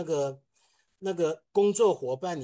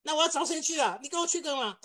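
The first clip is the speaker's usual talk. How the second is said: much higher, exasperated scolding; scolding boss; 12 semitones higher than usual. Retold or imitated. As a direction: play a boss who can't believe the letter. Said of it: "他就骂我说".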